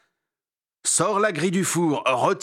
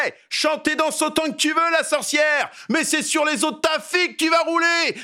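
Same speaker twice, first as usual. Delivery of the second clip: high, taunting yell; mocking shout; 10 semitones higher than usual. Dégourdi yells a high taunt at Baba Yaga. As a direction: crow it at the witch. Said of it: "et il crie".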